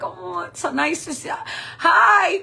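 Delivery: high, gushing put-on niceness; overdone friendly squeal; fake-sweet greeting. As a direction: cover impatience with a loud, high hi. Fake pleasant greeting after muttered gripe.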